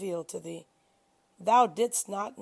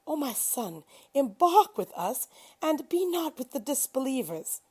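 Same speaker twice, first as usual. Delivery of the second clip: raised, pleading call to a son; 6 semitones higher than usual. A narrator's reading. Noah calls to his son from the ark, raised pleading call. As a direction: call across the water to your son, pleading. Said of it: "Noah called out to his son".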